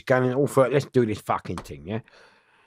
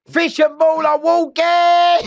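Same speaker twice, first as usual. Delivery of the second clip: high, slow drawn-out shout of a guard; slow, high guard's shout; shouted call; 7 dB louder, slower than usual. Retold or imitated. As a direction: bellow it like a screw calling down the wing. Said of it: "they went".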